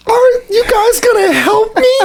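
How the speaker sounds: high, small, far-off whimper, slowly; soft pleading voice. Retold or imitated. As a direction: plead for help in a tiny high voice, like a hurt Karen. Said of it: "like, a very soft voice".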